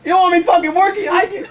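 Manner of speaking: high, hammed-up rant; mock tirade, voice strained upward; retold angry threat. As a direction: threaten them in exaggerated high-pitched indignation. Continues imagined rant at coworkers.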